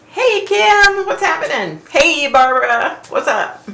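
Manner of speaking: high cheery greeting. Real, not acted. Greeting a viewer joining.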